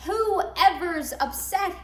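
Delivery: usual speaking voice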